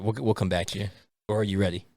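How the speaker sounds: ordinary talk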